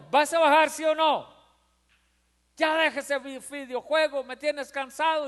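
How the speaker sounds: mother scolding her son over the video game; fed-up mother. Retold or imitated. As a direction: lose patience with the kid. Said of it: "ya la actitud es diferente".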